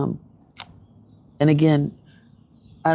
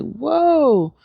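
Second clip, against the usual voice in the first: a high, loud, drawn-out whoa of disbelief; shocked whoa; 9 semitones higher, 4 dB louder than usual. Retold or imitated. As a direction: gasp it out like you can't believe what you just saw. Stunned by the show's moment.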